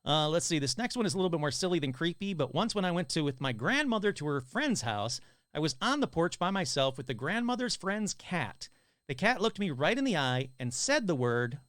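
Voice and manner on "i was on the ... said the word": usual speaking voice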